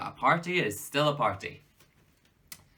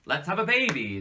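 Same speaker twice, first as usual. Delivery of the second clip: higher, louder, breezy exclamation; mock excitement; 7 semitones higher, 5 dB louder than usual. Dad mimicking a snap decision to adopt, mock-excited. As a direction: blurt it out like a giddy whim.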